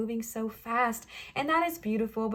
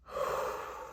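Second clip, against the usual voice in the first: long unvoiced breath out; shaky calming exhale; deep breath out; 8 dB quieter than usual. Real, not acted. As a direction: breathe out slowly to calm down. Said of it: "take a couple of deep breaths".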